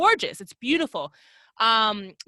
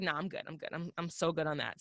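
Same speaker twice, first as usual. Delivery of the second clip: dismissive; 6 semitones lower, 10 dB quieter than usual. Retold or imitated. Quoting herself declining the bus ride.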